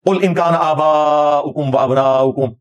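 slow, drawn-out recital; Quran recitation. Shown as a demonstration. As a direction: recite the verse with long held vowels. Recites the verse briefly. (Quran की ठहरी हुई तिलावत)

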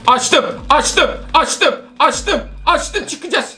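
high, breakneck repeated yelps; frantic panic. Real, not acted. Player escaping the doctor, repeating in frantic panic. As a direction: chant it urgently in excited panic.

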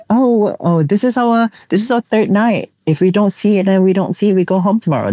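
Spanish tourists voiced. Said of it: "they asked me".

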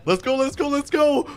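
high, excited chant; high-pitched rallying call; urgent hype. Pretend. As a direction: hurry the crew along. Rushing out to the job.

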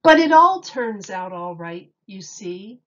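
lively picture-book read-aloud with big openings; reading to children. Acted. Miss Julie reading Dr. Seuss to children, animated storytime voice. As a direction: read it like storytime for little kids.

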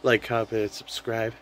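ordinary delivery

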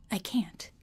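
barely a whisper, voice gone; can't get the words out above a breath; whispering that she can't play; whispered refusal. Real, not acted.

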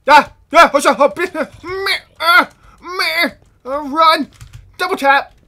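loud high panicked yells; hit hard crying out. Real, not acted. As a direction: cry out while being shot. Attacked by skeletons.

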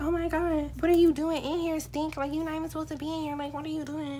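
high, soft fake bewilderment; feigned confusion. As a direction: play innocent in a small high voice. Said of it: "I'm acting confused".

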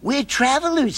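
put-on high little girl. A narrator's reading. Nancy reassuring the old man, high girl voice. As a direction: voice her high and sweet.

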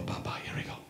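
whisper. Retold or imitated. Trainer mixing coffee.

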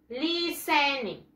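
listening slowly broken up, "liii-sen-ing"; correct pronunciation. Shown as a demonstration. Teacher has boys and girls repeat, slow "li-sen-ing". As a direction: draw out each syllable of listening, no t sound. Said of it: "The correct pronunciation".

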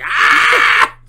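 high, loud yell; loud mock yell; shout. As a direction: yelp out loud. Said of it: "We're like".

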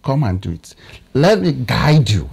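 ordinary talk

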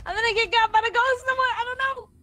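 frantic. Real, not acted. Fear of ghost in game.

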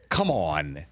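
drawn-out, falling, exasperated groan; exasperated disbelief. Retold or imitated. Fed-up viewer reacting to unrealistic TV, exasperated.

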